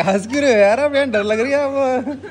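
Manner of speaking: high laughing protest. Real, not acted. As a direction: laugh through the words, high. Laughing while scared of monkeys.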